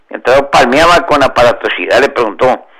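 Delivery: ordinary delivery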